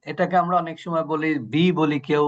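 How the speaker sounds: usual speaking voice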